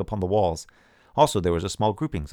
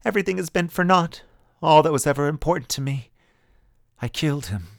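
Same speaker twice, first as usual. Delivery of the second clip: higher, lost and forlorn; 7 semitones higher, 4 dB louder than usual. A narrator's reading. Woman addressing the air, forlorn and lost. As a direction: say it to no one, lost. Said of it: "sounding forlorn and lost".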